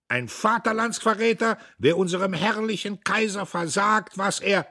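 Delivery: high, loud denunciation; death-defying shout. A narrator's reading. Dietrich denounces the traitors to the hall, defiant shouting. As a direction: denounce them at full pitch. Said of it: "schrie er mit Todesverachtung".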